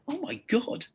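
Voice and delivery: high, soft breathy "Oh my God"; shocked gasp. Retold or imitated. Surgeon stunned by a patient's blunt reply, hushed shock. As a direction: caught completely off guard.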